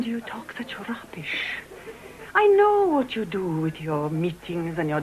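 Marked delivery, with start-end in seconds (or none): 0.0-1.6 hissed scorn under the breath; whispering her contempt to Catherine; contemptuous whisper. Acted. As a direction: whisper it with disdain.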